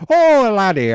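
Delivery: loud, high-pitched mock Irish cry; Irish accent, drawn-out and high. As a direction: belt it out as a hammed-up Irishman.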